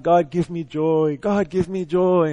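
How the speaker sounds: slow, drawn-out mock pleading; lightly acted crying prayer, words stretched; imitated tearful plea. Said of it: "he was really just crying".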